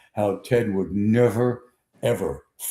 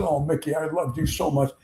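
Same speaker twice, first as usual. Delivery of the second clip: fawning fan; 5 semitones higher than usual. Retold or imitated. Adoring fans to their idol Mickey, fawning. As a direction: imitate an adoring fan, pitched up. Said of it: "when people fawned on him".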